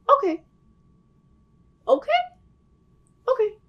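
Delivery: curt, dismissive okays, the middle one jumping high; mock stylist cutting her off, okay after okay; imitating the stylist. Mimicking the hairdresser's curt, dismissive okays.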